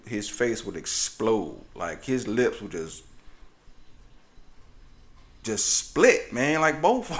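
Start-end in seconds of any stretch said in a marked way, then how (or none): none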